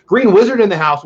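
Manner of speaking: loud, high welcome call; excited shout-out. Real, not acted. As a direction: announce the name with delight. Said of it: "I like saying that".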